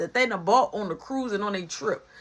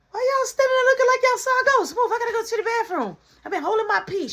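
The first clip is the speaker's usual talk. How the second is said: much higher, fast, loud hurried mom voice; mother in a hurry pushing past the kids; rushing mom; 13 semitones higher, 4 dB louder, much faster than usual. Retold or imitated. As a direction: mom bursting in, desperate for the bathroom.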